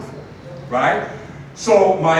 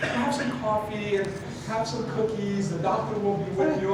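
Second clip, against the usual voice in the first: put-on soft, high, kindly old-lady voice; gentle older lady fussing over a guest; sweet grandmotherly receptionist; 4 semitones higher, 6 dB quieter than usual. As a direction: offer hospitality like a nice grandma. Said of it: "kinda like a grandmother, just really nice".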